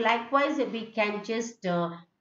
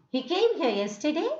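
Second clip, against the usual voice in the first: He came here yesterday rising at the end, a bit quick; question tune; 5 semitones higher, faster than usual. Shown as a demonstration. Teacher showing how a question asks for an answer. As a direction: ask it, the tune going up. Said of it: "our teacher is asking something".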